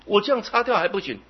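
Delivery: high, fast, loud protest; can't believe even this isn't enough; indignant disbelief. Retold or imitated. Young man protesting to the master, high and indignant.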